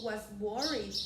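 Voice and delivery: plain reading voice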